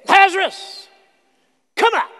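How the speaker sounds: high, ringing call into the tomb; commanding call. Acted. Jesus calling dead Lazarus from the tomb, high ringing command. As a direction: call him out of the grave.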